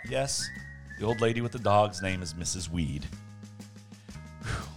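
ordinary delivery

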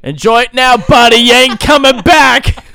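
mock threat.

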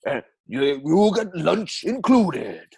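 Plainview read in Welsh; Welsh accent. Acted. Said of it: "that Welsh accent".

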